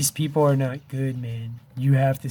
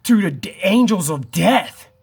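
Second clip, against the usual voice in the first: louder and higher, pressing the alarm; urgent raised voice; 6 semitones higher, 6 dB louder than usual. Real, not acted. Warning his viewers of coming doom, loud and urgent. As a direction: sound the alarm in earnest, raised and high.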